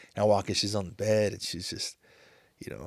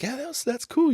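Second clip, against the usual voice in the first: lightly voiced wife, pitched higher; imitating his wife. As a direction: mimic her easy agreement in a higher voice. Wife voiced in retold chat.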